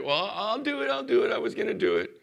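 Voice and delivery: put-on high, quick whiny kid excuse; whiny child. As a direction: whine like a stalling child. Child making excuses to parent.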